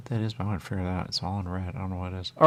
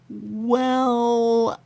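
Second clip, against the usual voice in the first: drawn-out high well; 13 semitones higher, 4 dB louder than usual. Pretend. Stretched character voice.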